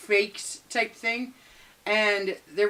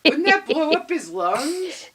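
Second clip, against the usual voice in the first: put-on puzzled child; 6 semitones higher, 4 dB louder than usual. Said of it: "I went".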